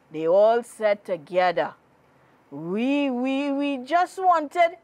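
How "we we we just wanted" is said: stammering fear. Acted. Frightened boys stammer an excuse.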